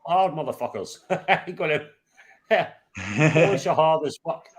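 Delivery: laughing. Real, not acted. Laughing banter about Poles.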